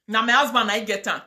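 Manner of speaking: ordinary talk, nothing marked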